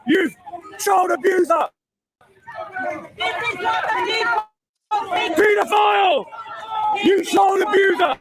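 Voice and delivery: furious repeated heckling from the crowd; real angry street heckle; heckling shout. Real, not acted. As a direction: yell the insults at the passing politician. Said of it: "Is that Starmer getting shouted at?".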